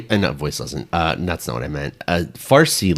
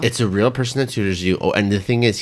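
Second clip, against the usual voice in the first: ordinary talk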